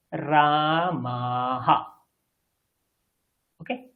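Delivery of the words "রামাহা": very slow "রামাহা", ending rising on "হা"; slow pronunciation demo. Shown as a demonstration. Teacher modelling visarga for students, very slow. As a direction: drag out every syllable of "রামাহা". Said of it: "উচ্চারণটা কি রকম হবে?".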